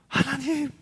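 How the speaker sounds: high, urgent cry for help; a believer in trouble crying out to God; desperate cry. Retold or imitated. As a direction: call out to God in panic.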